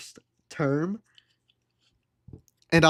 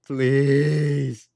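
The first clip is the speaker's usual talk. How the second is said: long drawn-out, voiced mock begging; exasperated plea; 2 semitones lower, 4 dB louder than usual. Man begging clingy date to back off, exasperated. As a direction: stretch PLEASE into one long pleading groan.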